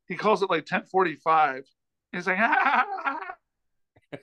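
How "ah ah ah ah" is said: high, mocking ha-ha laugh; imitated laugh. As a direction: imitate someone cackling at you down the phone. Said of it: "just laughing at me".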